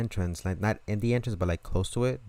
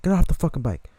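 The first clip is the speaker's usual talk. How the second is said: loud, higher barked command; shouted order; 7 semitones higher, 7 dB louder than usual. Retold or imitated. Owner ordering the thief off the bike, loud and higher.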